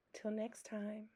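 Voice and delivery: very soft, voiceless goodbye; whispered sign-off. Real, not acted. Signing off to viewers at the end, whispered. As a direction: whisper it to close the video.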